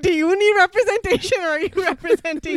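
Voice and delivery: high, loud, laughing disbelief; laughing, incredulous mimicry; laughing mock question. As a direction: imitate a doubting guard through laughter. Acts out sceptical guard, laughing.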